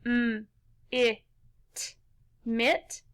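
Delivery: "m, i, t" as isolated short sounds, then "mit" whole; sounded out. Shown as a demonstration. Teacher tapping out the second syllable, sounded out. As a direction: tap out "m, i, t" one sound at a time, then blend to "mit". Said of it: "let's tap out those syllables".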